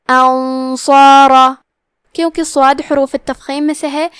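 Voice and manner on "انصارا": انصارا chanted slowly, the hidden noon held as a heavy nasal hum before ص; heavy ghunna. Shown as a demonstration. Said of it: "غنہ کو بھی بھاری آواز میں ادا کرنا ہے". (انصارا میں نون چھپا کر بھاری غنہ، آہستہ تلاوت)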